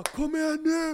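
high, loud yell to the crowd; a rider pumping up the arena with a high shout; hyping the crowd. Retold or imitated. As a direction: roar at the stands to get them going. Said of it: "Börjar gapa och skrika".

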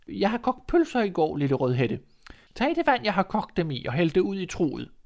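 high, kindly granny voice; an old woman with a clever plan; old grandmother. Acted. Grandmother to Red, high and kindly.

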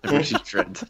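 high, giggly words breaking into laughter; laughing. Real, not acted. Telling her friend about the croc, laughing. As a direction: laugh your way through the line.

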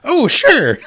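high, loud squeaky mouse. Acted. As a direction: answer eagerly as a little mouse. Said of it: "mouse answers".

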